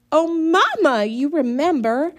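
high, loud honeyed coaxing; sweet as candy to mama; sugary sweet. A narrator's reading. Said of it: "voice was sweeter than a melted Mars bar".

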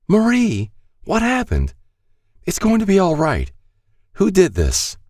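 put-on high, anxious young woman; frightened girl begging for answers; Star's frantic voice. A narrator's reading. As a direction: plead high and urgent over the wounded man. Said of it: "Star dropped to her knees".

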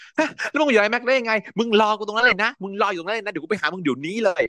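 high, heated retelling of a furious call; angry phone rant. Retold or imitated. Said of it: "นางก็เลยโทรไปวีน". (เล่าเสียงสูงแบบโวยวายใส่โทรศัพท์)

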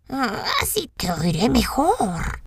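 lobo disfrazado, lento; voz de lobo. A narrator's reading. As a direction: lobo taimado contestando. Lobo responde. (voz de lobo astuto)

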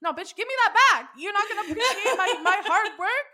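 high, laughing, hammed-up outrage; mock indignant demand. Killer boyfriend wanting his trophies back, mock outrage. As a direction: demand it back in comic, high-pitched panic.

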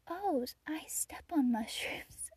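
put-on slow whispered child line; whispered little boy. Boy admits stepping on mushrooms, slow whisper. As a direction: whisper it like a proud tiny confession. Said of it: "And he's like".

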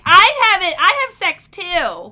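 high, loud excited squeal; squealing excitement. Retold or imitated. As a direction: shriek it like a giddy kid. Mocking Hannah in high squeal.

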